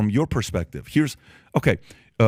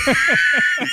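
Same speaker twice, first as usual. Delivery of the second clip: loud high laugh; 4 dB louder than usual. Real, not acted. Laughs at joke.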